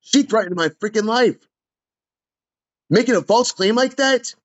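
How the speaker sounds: outraged that she lied about him; real fury. Real, not acted. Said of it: "I was furious".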